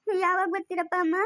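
crying as she asks; tearful. Acted. Daughter asks parents when they will return, tearful. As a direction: plead through tears. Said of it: "ಯಾಕ್ ನೀನ್ ಪದೇ ಪದೇ ಅಳ್ತಾ ಇರೋದು".

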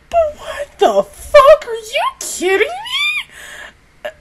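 high, slow, strained outcry of disbelief; overwhelmed outcry. Real, not acted. Fan crying out in disbelief at the fight. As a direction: cry it out like it's too much to bear.

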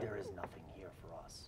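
low, whispered dragon; hushed creature voice, very soft. Acted. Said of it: "Is that the dragon voice?".

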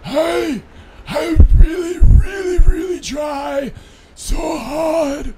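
high, loud, frantic shouting; a desperate little voice shouting. Acted.

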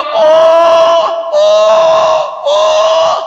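loud mock howls, pitched very high; long high "oh" wails, like shrieking devils; imitated shrieking wails. Preacher imitating the minions shrieking along with Iblis, loud mock wailing. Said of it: "Jerit-jerit juga".

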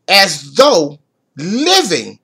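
very slow, drawn out; read slow. Shown as a demonstration. Preacher reading the verse slowly to the church, drawn out. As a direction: stretch every word out. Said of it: "I'm gonna read it slow".

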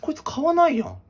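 high, sharp exasperated aside. Acted. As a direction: blurt it out in disbelief. Clerk aside about customer.

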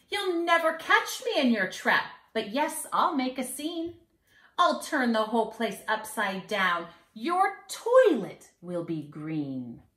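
put-on sing-song rhyme for kids; mischievous leprechaun. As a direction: read it like a cheeky little leprechaun bragging. Leprechaun taunts the children.

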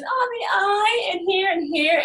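put-on high, dramatic child voice showing off her stings; a four-year-old milking it for the teacher; little girl showing stings. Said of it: "She goes".

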